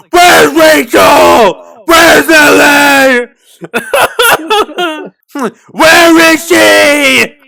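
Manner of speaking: slow mock Batman shout. Mocking the calm grandpa as a shouting Batman. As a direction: growl it out like a brooding Batman, very slow. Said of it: "be like Batman".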